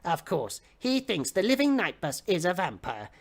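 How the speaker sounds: ordinary delivery, nothing marked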